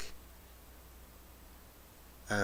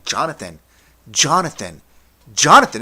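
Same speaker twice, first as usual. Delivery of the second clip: each call louder and higher; escalating teacher's shout; 5 semitones higher, 15 dB louder than usual. Retold or imitated. History teacher trying to get through to student, mounting shouts. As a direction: build the name to a full shout.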